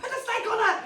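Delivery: very high squealed command through laughter; laughing mock order. Mum retelling the snake visit, laughing high command.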